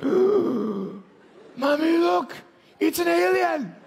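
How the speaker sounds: put-on very high, loud little-girl cry; frightened little girl. Scared little girl tells her mother she sees an alien, shrill and loud. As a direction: shriek it like a scared small child. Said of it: "She's like".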